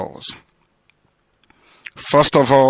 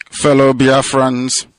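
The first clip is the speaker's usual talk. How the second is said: normal talking voice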